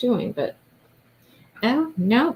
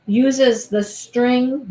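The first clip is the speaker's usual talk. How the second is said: drawn out; 4 semitones higher, 3 dB louder, much slower than usual. Real, not acted. Searching for the word.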